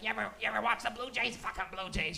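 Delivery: lightly voiced rambling crazy person; can't talk straight. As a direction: stumble through it, garbled and repetitive. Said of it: "just can't talk straight".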